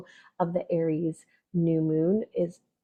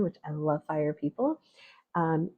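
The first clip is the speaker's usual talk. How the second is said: ordinary delivery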